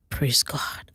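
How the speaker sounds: soft unvoiced whisper; whispered praise. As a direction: breathe it under your breath. Pastor quietly praising under breath.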